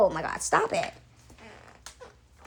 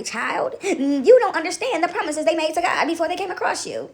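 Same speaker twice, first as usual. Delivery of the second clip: ordinary delivery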